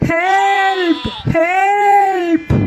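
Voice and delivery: desperate cry for help. Acted. Peter cries out as the wolf seizes a sheep, slow drawn-out cry. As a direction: stretch the help out, slow and crying.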